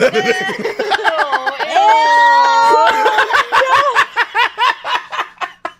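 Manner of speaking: high, loud shrieking laugh; helpless high-pitched howl of laughter; big laugh. Real, not acted. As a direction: burst into long, uncontrolled laughter. Huge laugh at handshake joke.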